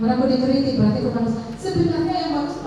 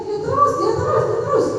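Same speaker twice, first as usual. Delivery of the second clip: jealous whine; 10 semitones higher than usual. Retold or imitated. Envious colleague complaining.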